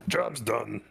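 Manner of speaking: lightly voiced orc worker. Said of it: "like, an actual orc voice".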